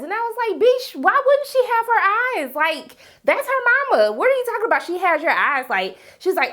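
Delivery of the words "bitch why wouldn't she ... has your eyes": high-pitched, incredulous clapback; indignant retort. Acted. Viewer talking back to a woman on screen, indignant and high. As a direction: call her out in disbelief, voice jumping high.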